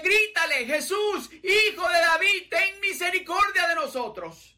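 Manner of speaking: very high shouted cry for mercy; desperate shout. Acted. Blind men shouting to Jesus over the crowd, very high.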